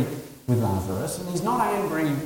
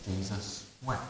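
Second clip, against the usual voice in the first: hushed; 5 semitones lower, 13 dB quieter than usual. Acted. Reading the short verse, hushed.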